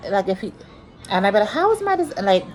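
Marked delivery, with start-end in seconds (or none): 1.5-2.2 high-pitched, retold rebuttal; acted protest. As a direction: start arguing back in a higher voice. Acts out her own rebuttal.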